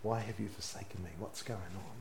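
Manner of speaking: hushed, breathless plea, fast and barely voiced; whispered. Jesus in pain, whispering to the Father, hushed and fast. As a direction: whisper it as if the pain has drained you. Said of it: "he would have whispered".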